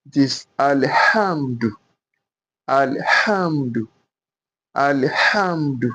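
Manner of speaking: slow "alhamdu" with a breathed h; Arabic alhamdu. Shown as a demonstration. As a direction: draw out "alhamdu" and breathe through the h. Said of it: "We are going to breathe out".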